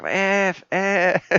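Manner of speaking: hammed-up stung teacher swearing; retold swearing. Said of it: "I'm like".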